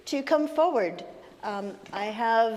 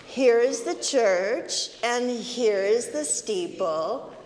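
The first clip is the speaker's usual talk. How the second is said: slow, sing-song rhyme recital; leading a children's finger rhyme; nursery rhyme; 3 dB louder, slower than usual. Acted.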